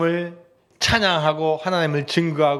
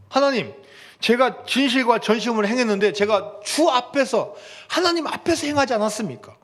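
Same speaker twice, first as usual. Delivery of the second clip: high, urgent appeal to God; pleading prayer; 7 semitones higher than usual. Acted. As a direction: press God as if pleading your case. Preacher acts Hezekiah pleading, high.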